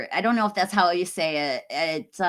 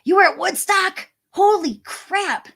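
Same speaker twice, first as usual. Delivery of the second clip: high, loud gasp of amazement, slowing on holy crap; floored by the news; stunned disbelief; 11 semitones higher, 5 dB louder than usual. Real, not acted. Streamer reacting to a viewer who was at Woodstock, high astonished exclaim.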